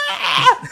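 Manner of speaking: high laughing mock yelp; retold scream. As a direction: squeal as if jolted by a jump scare. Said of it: "I legit scream out in the theater".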